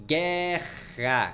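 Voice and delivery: "Guerra" drawn out as guer-ra; stretched-out "Guerra", each syllable held; syllable division. Shown as a demonstration. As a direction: say "Guerra" slowly in two syllables. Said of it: "Como é que você vai, separa?".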